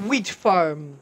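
ordinary talk, nothing marked

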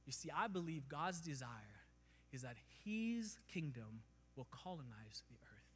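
ordinary talk, nothing marked

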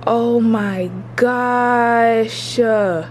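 loud, slow food rapture; excited. Real, not acted. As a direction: lose it over the smell. Overwhelmed by food smell.

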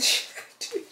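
ordinary talk

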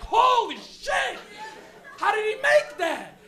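slow, high, loud amazed shout. Retold or imitated. Viewer at home shouting slowly in amazed disbelief. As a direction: stretch it out in disbelief. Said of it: "you're like".

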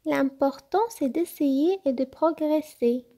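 high-pitched little fox voice, reassuring; high, earnest young animal character; wise little fox, encouraging. Acted. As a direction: explain kindly in a high little voice.

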